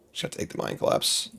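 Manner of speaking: whispered musing. Real, not acted. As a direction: whisper it while deciding. Muttering resigned pick.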